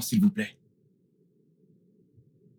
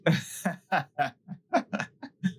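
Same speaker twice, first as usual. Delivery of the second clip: amused breathy laugh; light laugh; 4 semitones higher than usual. Acted. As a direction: laugh it off, rising. Laughs at his answer.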